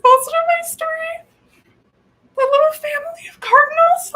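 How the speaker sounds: squeaky high, breathy gushing; rising to a high squeaky whisper; gushing squeal. Real, not acted. Gushing about baby cardinals.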